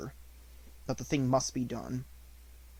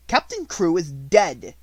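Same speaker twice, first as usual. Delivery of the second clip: slow, louder cold pronouncement; curt announcement; 4 semitones higher, 7 dB louder, slower than usual. A narrator's reading. Headmistress telling her sister the news, slow and cold. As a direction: drop the news like a stone.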